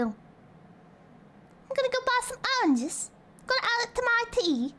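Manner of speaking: high, drawn-out cartoon British accent; mock British. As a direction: ham up a silly Brit. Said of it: "They'd sound like that".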